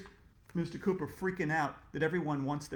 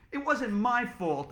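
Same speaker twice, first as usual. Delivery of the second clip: defensive protest; 7 semitones higher, 5 dB louder, slower than usual. Acted. Kid defends himself.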